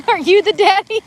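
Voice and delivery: high, giggly "are you the papa" ask; mock paternity question. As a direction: ham it up at his doorstep, voice high. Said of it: "step being like".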